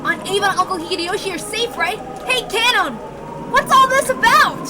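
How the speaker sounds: high, loud, rattled questions; a shaken Battler voice; alarmed young man. Acted. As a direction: demand answers from the servant.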